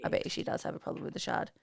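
fast whisper; whispering conspiratorially. Acted. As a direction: whisper it like a secret. Conspiratorial joke about mum.

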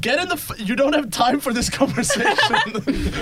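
high-pitched, exasperated shout; impatient shout breaking toward laughter; urgent yelled order. Acted. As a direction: cut the argument short, loud and high. Impatient order to get in boat.

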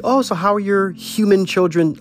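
mocking pet owner. Pet owner quizzing a parent, high mocking voice. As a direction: lampoon a pet parent asking about human children. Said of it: "I've seen people go like".

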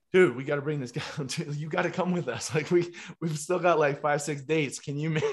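loud, high, eager acted invitation; imitated excited pleading. Guitarist invites the trainer on tour, imitated excited plea. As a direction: talk someone into joining, thrilled and urgent.

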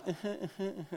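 soft, high little whimpers; imitated dainty sobbing, quiet; small mock crying. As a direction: whimper politely in pretend tears. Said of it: "Like, not like".

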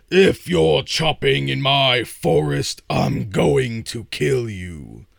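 loud, high menacing troll. Acted. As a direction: bellow the threat like a troll. Troll threatens the ash lad.

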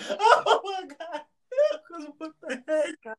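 high, drawn-out words through laughter; laughing too hard to talk; helpless laughter. Real, not acted. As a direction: gasp it out while cracking up. Helpless laughter after own joke.

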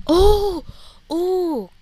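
high, loud oohs of realisation; sudden excitement. Real, not acted. Podcaster as the thoughts come rolling in, excited. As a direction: burst out as the idea hits. Sudden idea while talking.

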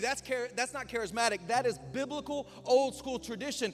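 loud, high preaching build; fiery preaching. Acted. Pastor preaching hard to the church, loud and high. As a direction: preach it out to the congregation.

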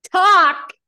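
a loud, high, falling Talk; disbelieving shout. Retold or imitated. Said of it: "and I was like".

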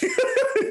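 sudden high-pitched laugh breaking out; high burst of laughter. Real, not acted. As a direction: laugh out loud, high and short. Laughs at his own story.

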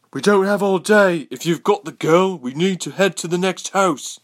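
high, loud impatient soldier's call; calling from downstairs. A narrator's reading. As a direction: holler up the stairs to your squad. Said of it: "another soldier said from downstairs".

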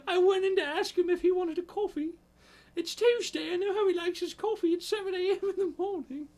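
high, loud British lady gasping out the scandal; hammed-up posh British woman. Girlfriend telling boyfriend William about his naked flatmate, hammed-up posh British accent. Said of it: "you have to do a British accent".

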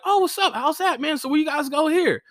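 very high, chirpy friendly greeting; sing-song niceness; friendly self. Retold or imitated. Comic showing how friendly he was to staff, high chirpy voice. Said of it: "me being like".

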